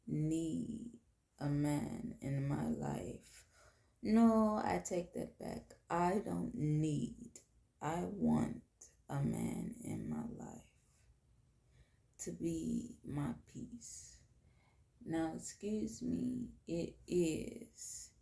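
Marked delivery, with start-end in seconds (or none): none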